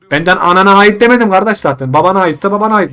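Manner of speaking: loud, high exasperated snapping; irritated loud comeback; annoyed retort. Real, not acted. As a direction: snap back at the caller. Arguing with caller.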